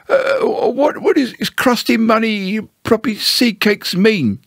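high, stumbling bewildered bear; Pooh. Acted. Pooh asks Owl what long words mean, baffled.

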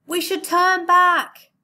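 loud, high, slow yell over storm. Acted. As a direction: shout it into the wind and rain. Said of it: "someone yelled".

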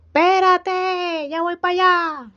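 high, drawn-out yell to the door; calling out. Acted. Yajaira yells to visitors at the door, calling out. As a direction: shout it across the house, stretching it out.

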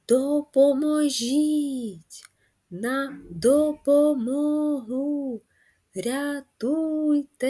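high, slow drawn-out cries; crying for help. Shown as a demonstration. Teacher shows kids a call for help. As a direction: stretch each word like a call for help. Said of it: "викрикує людина, яка просить про допомогу".

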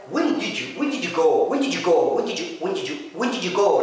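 "when did you" blurred into "wendidju"; connected speech drill. Shown as a demonstration. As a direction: run the words together like a native. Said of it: "ركزوا على كلامي". (ربط الكلمات بسرعة)

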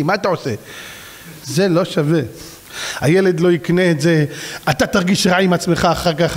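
ordinary talk, nothing marked

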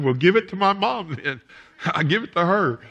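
put-on high, loud comic kid's objection; childish protest. Preacher as a kid telling the doctor to jab mom, mock protest. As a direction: imitate a child squirming out of a shot.

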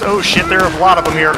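ordinary talk, nothing marked

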